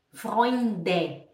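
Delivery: Freunde slowly as "froyn-de", n sustained; drawn-out N. Shown as a demonstration. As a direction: linger on the n of Freunde. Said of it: "tem que puxar o N".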